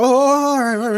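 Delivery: a flustered, yielding oh all right; retelling the patient being turned away, flustered; startled compliance. Retold or imitated. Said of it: "He's like".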